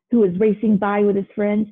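ordinary delivery, nothing marked

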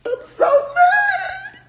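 very slow high crying shout; drawn-out wail. Real, not acted. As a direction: wail it long like a tantrum. Venting frustration.

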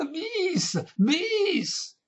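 very high, stretched shouts; shouting for an encore. A narrator's reading. Deaf old lady clapping for Paganini, high enthusiastic shout. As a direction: cry out high like an avid fan. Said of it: "continuava a batter le mani e a gridare".

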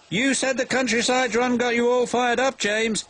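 high-pitched, cheeky character voice; teasing little engine. A narrator's reading. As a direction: needle him in a high voice. Percy teases James after the fire.

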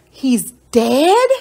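loud, rising disbelief; stunned by the news; shocked question. Acted. Child learns of boy's drowning.

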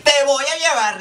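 high, mock-stern warning; mock threatening mom. Makeup artist voicing the mom warning the child, high and mock-stern. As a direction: play the mom scaring the kid. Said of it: "yo me pongo en el papel".

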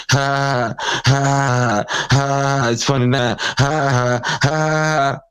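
high, sarcastic fake laugh; mock laughter. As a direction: cackle back at them with scorn. Mocking the trolls who laugh.

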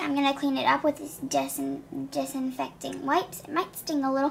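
ordinary talk, nothing marked

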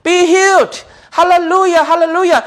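high, mock healing command and hallelujahs; pretend faith healer. Preacher acting out a pretend healer, mock high proclamation. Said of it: "lấy pretend".

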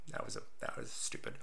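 quick embarrassed whisper; whispered aside. Real, not acted. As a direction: whisper it under your breath.

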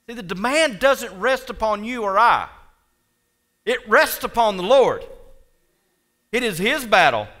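loud, high, emphatic pulpit voice; preacher proclaiming with conviction; full-force preaching. Real, not acted. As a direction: hammer the point home to the congregation.

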